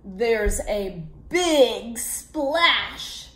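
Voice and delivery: plain reading voice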